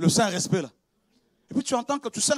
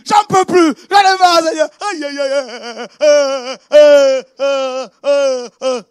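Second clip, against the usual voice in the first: loud, higher despairing shout to the Lord; anguished preaching outcry; 5 semitones higher, 12 dB louder, much slower than usual. Acted. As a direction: cry out in mock despair, repeating aïe. Preacher acting out his despair to God.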